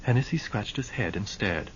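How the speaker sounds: plain reading voice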